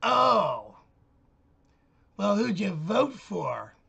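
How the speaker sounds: put-on loud, slow, sneering voice for the young neighbor; mocking neighbor woman. Man mimicking the neighbor woman's retort, loud and sneering. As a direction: play the girl huffy and sarcastic, dragged out.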